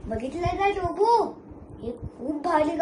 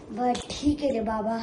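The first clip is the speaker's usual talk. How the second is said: ordinary delivery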